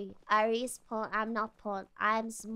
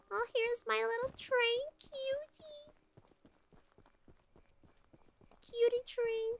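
cutesy cooing; 8 semitones higher, 5 dB quieter than usual. Real, not acted. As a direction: coo at it like a pet. Cooing at the game's train.

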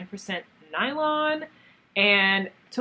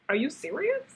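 higher, rising are you serious; mock disbelief; 7 semitones higher than usual. Recalling seeing the yarn in the shop, higher mock disbelief. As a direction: pitch it up in disbelief. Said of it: "I was like".

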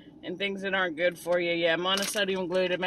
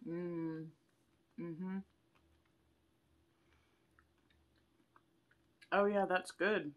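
savoring while swallowing; chewing; 4 semitones lower, 10 dB quieter, slower than usual. Real, not acted. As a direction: mouth full of ice cream. Seen 0: Closed lips, savoring mouth movements, bitten ice cream bar held at chin.

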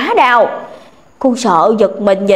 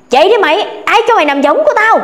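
much higher, quick indignant rebuke; scolding snap; 7 semitones higher, faster than usual. A narrator's reading. Ghost father-in-law scolding daughter-in-law off his hammock, sharp snap. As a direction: snap at her for taking the hammock. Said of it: "Cô nghe nạt rõ ràng".

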